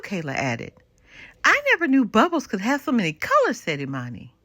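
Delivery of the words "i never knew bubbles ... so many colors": high and fast, tumbling out in wonder; amazed child. Acted. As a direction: rush it like a kid who just discovered something. Child amazed by bubble colors.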